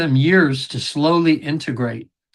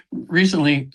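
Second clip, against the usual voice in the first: ordinary delivery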